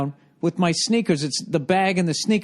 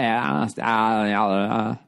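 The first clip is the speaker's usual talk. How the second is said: slow, lower drawled mock accent; 5 semitones lower, much slower than usual. Mocking the nurse's apology, slow drawled accent. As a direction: drag the apology out in her accent. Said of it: "that accent".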